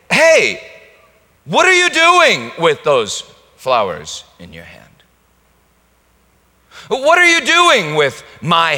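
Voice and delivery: loud high accusing shout; demanding master. Pretend. Master confronting a steward over stolen gifts, shouting. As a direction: demand answers like an angry owner.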